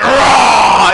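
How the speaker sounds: loud playful monster roar. Retold or imitated. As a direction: roar to scare a little girl. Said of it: "and I went".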